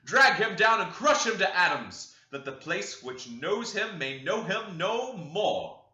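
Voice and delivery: loud, high ranting sermon voice; fiery preaching. Acted. Preacher Branderham rousing the congregation, loud and high. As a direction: thunder it from the pulpit.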